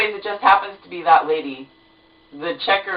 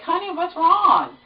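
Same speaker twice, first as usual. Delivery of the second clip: husband imitated much higher and slow; mock a concerned husband, pitched up; worried husband; 12 semitones higher, slower than usual. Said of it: "he's like".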